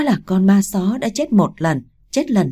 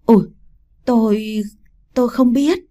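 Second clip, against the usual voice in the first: higher, halting denial; frightened stammer; 4 semitones higher than usual. A narrator's reading. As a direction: stammer out a plea in fear. Terrified woman answers the ghost.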